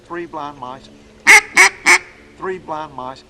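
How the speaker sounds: rhythmic three blind mice, "three" stressed each time; hen mallard cadence. Shown as a demonstration. Teacher drilling the duck call rhythm, chanted beat. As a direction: chant it like a nursery rhyme. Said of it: "You got the cadence of a hen mallard".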